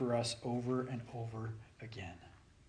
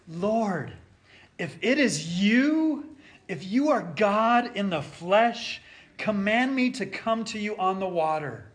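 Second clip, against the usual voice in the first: Peter's plea; 6 semitones higher, 7 dB louder than usual. Acted. Peter calling to Jesus.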